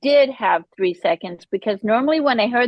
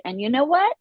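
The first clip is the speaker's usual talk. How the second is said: ordinary talk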